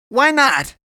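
high, very loud challenge; yelled retort; shouted comeback. A narrator's reading. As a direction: fling the question back at a shout. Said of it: "he returned".

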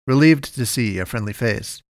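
usual speaking voice

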